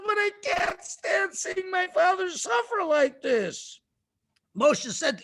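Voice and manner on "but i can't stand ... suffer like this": high, wailing mock distress; anguished plea. Pharaoh's daughter pleads to Moshe for her suffering father, anguished.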